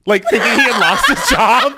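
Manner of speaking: high, loud laughing explanation; giggling at own blunder. Real, not acted. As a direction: laugh through the words.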